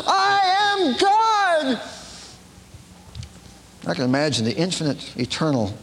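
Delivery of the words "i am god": high, slow, crying it out; hammed-up cry of I am God; mock shouted declaration. MacLaine shouting on command to her teacher, high and drawn out. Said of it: "And she says".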